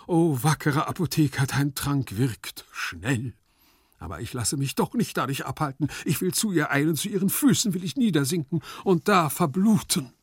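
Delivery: high, impassioned outburst; melodramatic cry. Acted. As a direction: declaim in desperate passion. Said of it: "schrie der Marchese noch immer".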